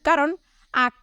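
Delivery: ordinary delivery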